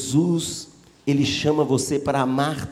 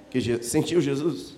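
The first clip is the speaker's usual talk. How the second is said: ordinary talk, nothing marked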